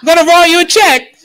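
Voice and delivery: loud, high-pitched Cockney cheer; cute little Cockney accent. Acted. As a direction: holler it like a chirpy Cockney. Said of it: "little Cockney accent".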